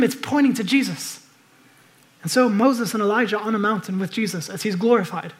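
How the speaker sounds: normal talking voice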